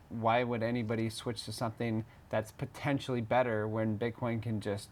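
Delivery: ordinary talk, nothing marked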